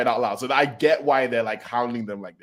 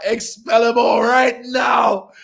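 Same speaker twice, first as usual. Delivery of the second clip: much higher, louder, slow yell; mock angry shout; 12 semitones higher, 7 dB louder, much slower than usual. Bakugo demanding the fans be expelled, mock shout. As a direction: bark the order like a hothead. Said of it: "Bakugo's like".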